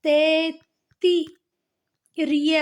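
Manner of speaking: slow, broken-up Taittiriya, "tai-tti-ree-ya"; syllable by syllable. Shown as a demonstration. Teacher showing students how it is read, Taittiriya slowly split up. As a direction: sound out Taittiriya in separate stretched syllables. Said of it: "जिसे हम पढ़ते हैं".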